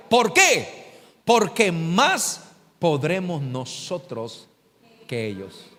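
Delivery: ordinary talk, nothing marked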